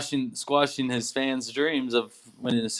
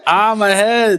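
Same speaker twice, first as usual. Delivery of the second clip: high, loud pained cry; mock yelp of a hurt crook; Home Alone burglar; 7 semitones higher, 9 dB louder, slower than usual. Imitating the burglar hit on the head, high loud yelp. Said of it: "Because of Home Alone".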